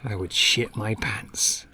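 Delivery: ordinary talk, nothing marked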